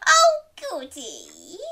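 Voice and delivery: slow, high, loud relish; delighted granny. Acted. Granny delighted about breakfast, gleeful voice. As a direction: draw it out with loud glee.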